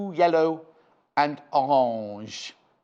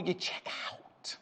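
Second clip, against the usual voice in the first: whispered aside, slower; conspiratorial whisper; 14 dB quieter, slower than usual. Acted. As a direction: lean in and whisper the tip. Sales aside to viewers.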